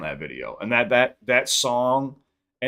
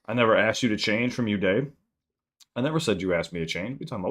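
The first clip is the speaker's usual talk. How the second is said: ordinary delivery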